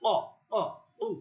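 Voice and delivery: অ, অ, উ loud, from the back of the throat; deep-throat qaf. Shown as a demonstration. Teacher shows where qaf forms, deep-throat অ, অ, উ. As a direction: punch out a heavy qaf in অ, অ, উ. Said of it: "এটা একটু কণ্ঠনালীর শেষ থেকে".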